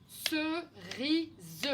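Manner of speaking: "Cerise" in separated syllables, slowly sounded out. Shown as a demonstration. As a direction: sound out "Cerise" beat by beat for young learners. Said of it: "J'entends le son I dans la deuxième syllabe".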